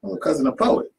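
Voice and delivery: lightly played man bragging; boastful claim. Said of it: "He was like".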